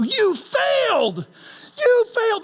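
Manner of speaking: high, slow, cheering cry of You failed; celebrating a failure like a win; cheering family. Retold or imitated. Family cheering the boy after the peanut butter mess, high and joyful. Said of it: "and they go".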